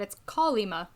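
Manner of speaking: Kolyma with stress on the first syllable, KO-lyma. Shown as a demonstration. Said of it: "I didn't put the stress on the second syllable".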